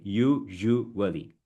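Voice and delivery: USUALLY slowly as yoo, zhoo, wuh-lee; syllable-by-syllable. Shown as a demonstration. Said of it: "Break it, guys".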